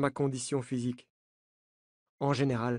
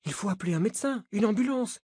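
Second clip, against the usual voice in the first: urgent alarm; 7 semitones higher, faster than usual. A narrator's reading. Nina urgent after a fall.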